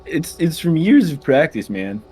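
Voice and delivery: a hint of a mellow stoner; Tommy Chong voice. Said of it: "You're really good at Tommy Chong".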